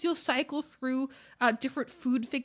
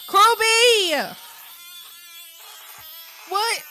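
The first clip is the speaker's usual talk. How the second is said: high, loud call; loud high-pitched call; 5 semitones higher, 9 dB louder than usual. Real, not acted. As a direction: shout the name across the room. Calling out a name.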